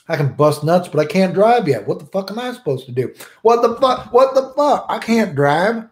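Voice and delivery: high, rising indignant rant; comic outrage at not being able to drive; exasperated rant. Acted. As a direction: throw up your hands in disbelief. Ranting at his younger self.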